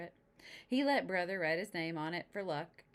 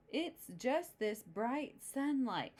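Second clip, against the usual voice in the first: sniffly; 6 semitones higher, much slower than usual. Acted. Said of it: "sniffed Papa".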